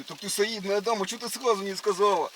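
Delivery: ordinary talk, nothing marked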